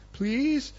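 PLEASE very high with a rising, wheedling end; hammed-up meek PLEASE, lifting at the end; suddenly pleading. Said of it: "his tone has changed".

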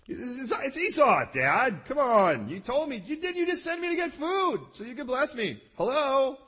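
high, loud exasperated son protesting; indignant son. Retold or imitated. Preacher voicing Esau protesting to his father, high and exasperated. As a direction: voice Esau pleading with his confused dad.

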